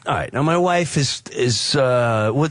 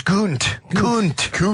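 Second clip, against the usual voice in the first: light imitation of his cursing; Geordie accent; 4 semitones higher, much slower than usual. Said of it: "He says".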